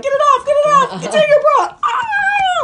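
high, loud panicked shriek; panicked screaming. Pretend. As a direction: scream it in terror. Imagined panicked reaction.

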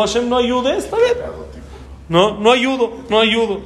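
mock his arrogance; mocking retelling. Preacher mocking the general's words to God. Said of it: "Porque él dijo".

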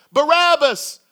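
loud, high chanted name; crowd shouting. Acted. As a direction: call it out like a mob. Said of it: "you hear them shouting".

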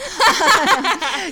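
high laughing fit; burst of laughter. Real, not acted. Host joking about her marriage, high laughter. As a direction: crack up at your own joke.

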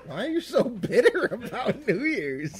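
laughing. Real, not acted. Teasing friend about hating holiday.

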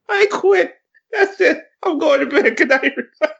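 high, laughing fake resignation; laugh-crying as you quit; laughing mock quit. Real, not acted. Mock quitting while laughing.

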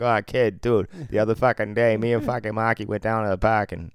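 loud, hammed-up Boston accent. Mocking himself on the air to his co-host, Boston accent. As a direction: put on a brash Boston townie accent. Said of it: "I'm all of a sudden from fucking Boston".

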